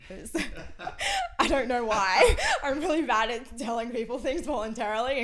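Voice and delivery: bursting into embarrassed laughter; laughing. Real, not acted. Admitting to the host she hid it, laughing loud. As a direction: laugh loudly through the admission.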